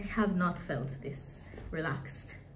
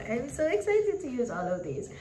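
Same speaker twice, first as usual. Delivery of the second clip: higher, gushing excitement; even more excited; 6 semitones higher, 3 dB louder than usual. Real, not acted. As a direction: build the excitement up. Repeats it, higher and brighter.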